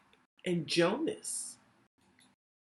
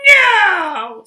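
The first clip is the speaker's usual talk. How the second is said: much higher, much louder, slow shouted goblin; 13 semitones higher, 18 dB louder than usual. Acted. Goblin taunts Lily with a deal, high slow shout. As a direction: bellow it like a gleeful goblin. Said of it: "smiled the goblin".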